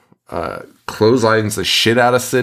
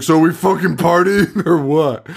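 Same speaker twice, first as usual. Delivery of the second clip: party bro voice; 6 semitones higher than usual. Retold or imitated. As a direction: burst in like a rowdy partier. Party guy bursting in.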